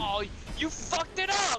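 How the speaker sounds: high, loud, slow yell; outraged yell. Real, not acted. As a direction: yell at a blunder. Friend ruined moment.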